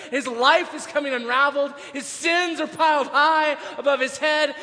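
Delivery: building gospel proclamation at full volume; full-force preaching. Acted. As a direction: preach it hard, voice lifted and driving.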